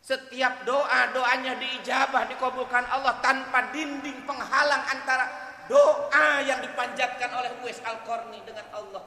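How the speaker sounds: booming pulpit voice; forceful preaching. Acted. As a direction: proclaim it to the congregation.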